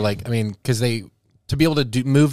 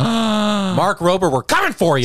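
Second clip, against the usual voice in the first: slow, higher mock threat; mock menace; 6 semitones higher, slower than usual. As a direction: drag it out like a movie villain. Mock threat to Mark Rober.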